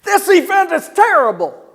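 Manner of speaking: furious, the event itself is awful; yelled complaint; angry outburst. Shown as a demonstration. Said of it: "the tonality and the physiology outplay the words".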